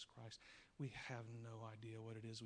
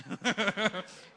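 big burst of laughter; hearty real laugh; loud laugh; 8 semitones higher, 17 dB louder than usual. Real, not acted. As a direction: crack up loudly at the joke. Laughs at own impression.

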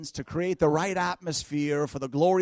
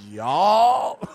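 long, loud Southern drawl on y'all; mock Southern y'all; 8 dB louder than usual. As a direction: imitate a wife's Southern y'all, rising and loud. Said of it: "y'all sneak out of my wife's mouth".

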